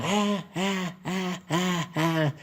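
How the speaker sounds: high mock baby wail; baby crying. Narrator imitating himself as a crying baby, high wail. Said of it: "Entonces yo era".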